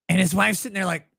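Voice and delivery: ordinary talk, nothing marked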